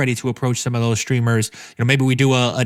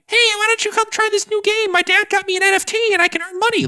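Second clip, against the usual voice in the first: sustained falsetto child impression; hammed-up squeaky kid telling a friend; mimicked kid voice. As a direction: pitch it way up like an eager kid. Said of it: "not gonna do that for the podcast voice".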